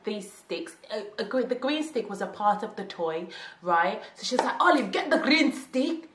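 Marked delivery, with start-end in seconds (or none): 4.6-6.1 higher, louder, slow mock-menacing mum; stern mum. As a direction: threaten the kid like a strict African mum. Mum threatening with the stick.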